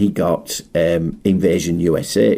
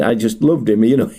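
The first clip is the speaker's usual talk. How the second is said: usual speaking voice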